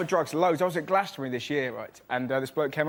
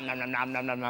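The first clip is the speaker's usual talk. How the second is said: lower, softer nom-nom gobbling; munching imitation; 3 semitones lower, 5 dB quieter than usual. Comic acts out shovelling in sweets, munching noises. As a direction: imitate greedy chewing, quietly.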